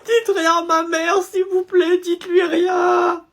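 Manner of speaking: high, slow sobbing plea; crying. Acted. Pupil sobbing to the teacher not to tell his mother. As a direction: sob it out slowly.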